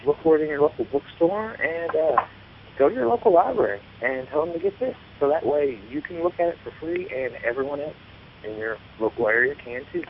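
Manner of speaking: normal talking voice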